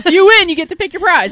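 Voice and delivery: loud, fast and high; excited shout. Real, not acted. Shouted prize announcement.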